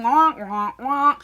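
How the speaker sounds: loud muffled-trombone wah noises; wah-wah like garbled cartoon speech; Charlie Brown cartoon wah-wah. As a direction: imitate the droning cartoon grown-up voice. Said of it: "like the Charlie Brown".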